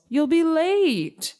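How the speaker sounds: slow, high-pitched sing-out warning; calling out. A narrator's reading. Mama hurrying her child for school, calling out. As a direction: stretch it high like a call from afar. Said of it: "Mama calls".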